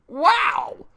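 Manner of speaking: loud, high-pitched wow; hammed-up amazed shout; excited wow. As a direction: burst out in amazement. Excited character reaction while reading dialogue.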